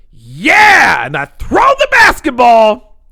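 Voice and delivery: loud, high triumphant shout; mock effort yell. Reenacting his half-court throw, all-out mock yell. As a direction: yell it as if hurling the ball.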